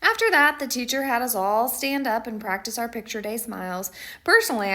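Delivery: ordinary delivery, nothing marked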